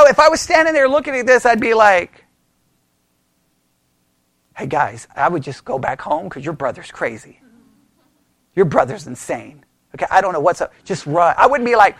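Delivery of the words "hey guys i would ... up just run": hushed warning. Pretend. Onlooker warns brothers in hushed tone.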